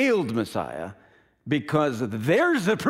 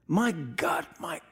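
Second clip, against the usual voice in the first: high, breaking, half-whispered plea; anguished cry; 9 semitones higher, 3 dB quieter than usual. Acted. Jesus on the cross crying to God, anguished. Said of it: "It's the cry of anguish".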